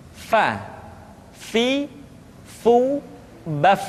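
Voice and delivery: "Fa, fi, fu, baf" with air gently escaping on each f; soft airy f. Shown as a demonstration. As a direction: let the breath out softly through each f in "Fa, fi, fu, baf". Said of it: "Suara dan angin mesti keluar dengan lembut".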